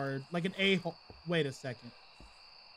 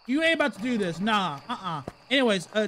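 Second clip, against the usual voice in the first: ordinary talk, nothing marked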